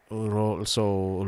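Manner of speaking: normal talking voice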